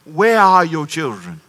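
slow, lightly voiced late-night commercial voice; TV announcer. Pastor quoting a late-night TV ad, slow and ominous. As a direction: ask it like the old public service ad. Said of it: "Remember this commercial?".

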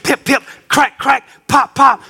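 pip, crack and pop shouted, building; shouted cracking sounds. Acted. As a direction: call out the hatching noises. Shell breaking, shouted.

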